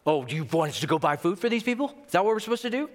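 sarcastic question climbing high; thick sarcasm. Acted. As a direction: feign asking, dripping with sarcasm. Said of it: "It's thick with sarcasm".

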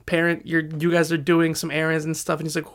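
ordinary delivery

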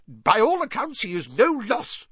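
high, loud blustering; blustered. A narrator's reading. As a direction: bluster dismissively like a pompous minister. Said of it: "blustered Fudge".

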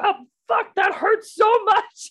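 high, loud re-enacted cry of pain; acted-out agonized outburst; retold pain. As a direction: yelp it out high, dragging the words. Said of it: "it hurt a lot".

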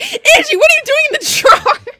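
very high, loud laughing squeal; squealing laughing question at a friend; laughing shriek. Retold or imitated. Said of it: "you literally said that".

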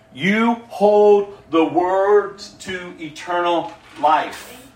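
slow, drawn-out declaration; weighty declaration. Acted. Disciples answering Jesus, weighty declaration. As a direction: stretch out each word with deliberate weight.

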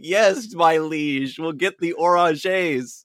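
meek servant voice. Retold or imitated. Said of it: "Everybody's gonna be like".

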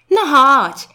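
high, loud invitation; urging young classmate. A narrator's reading. Garbusek urging wavering Zygzak to come, encouraging. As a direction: coax a hesitant friend along.

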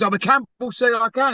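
high, whining insistence; mock the pleading guy; whiny protest. Stalker refuses to accept.

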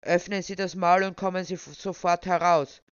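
loud, strained official order. A narrator's reading. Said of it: "erhob seine Stimme im vollen Falsett".

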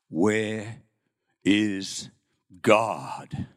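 low, slow booming priest voice; a big priest's low thundering question. Acted. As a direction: boom it through a cathedral. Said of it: "he boomed in the cathedral".

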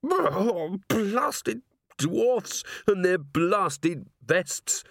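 high, very slow pained growl; whimper becoming voice. A narrator's reading. Angua changing back from wolf, cursing in pain. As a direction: drag the words out of an animal whimper. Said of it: "whimpering, which by degrees became a voice".

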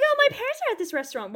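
high, rushed mock girlish excuse; Gwen Stacy. Friend mocking a character's restaurant excuse, high and quick. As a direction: imitate her in a squeaky, hurried voice. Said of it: "Gwen Stacy shows up".

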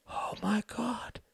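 slow, high, aghast whisper; hoarse. A narrator's reading. Ruth seeing the burning house from the cab, hoarse. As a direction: breathe it out in horror, voiceless. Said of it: "said Ruth hoarsely".

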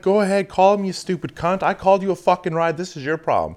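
replaying his fed-up answer; retold angry retort. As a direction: snap back at her in a retelling. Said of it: "And I'm like".

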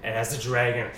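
loud, slow rising battle narration; epic narration. Acted. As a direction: narrate the clash with intensity.

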